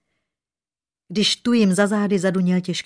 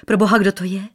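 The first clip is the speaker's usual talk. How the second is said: louder, startled exclamation; frightened cry; 6 dB louder, faster than usual. A narrator's reading. Princess spotting a stranger by the window, frightened cry. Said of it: "dívka vyděšeně vykřikla".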